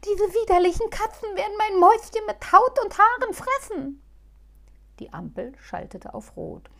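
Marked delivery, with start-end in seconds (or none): 0.0-4.0 high, loud anxious wail; whining. Acted. Halbschuh whining to Muff at the crossing. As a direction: wail it again in despair. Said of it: "jammerte Halbschuh aufs Neue".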